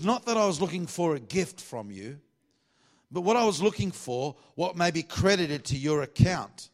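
high, loud, emphatic pulpit reading; scripture reading. Acted. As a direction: declare the verse, stretching the key words.

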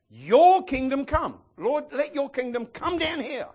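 high, loud, slow cry of prayer; fervent preaching. Acted. Pastor leads the church in fervent prayer. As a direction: call the kingdom down with fire.

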